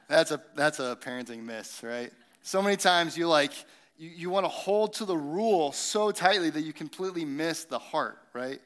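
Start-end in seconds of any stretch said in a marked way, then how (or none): none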